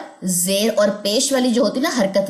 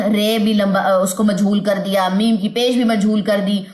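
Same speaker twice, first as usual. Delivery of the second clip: ordinary talk, nothing marked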